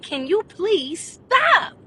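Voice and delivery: high, slow, sugary please stop; exaggerated polite plea. Asking the noisy girl to stop, high slow sweet plea. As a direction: ask sweetly, dragging it out. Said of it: "I finally said".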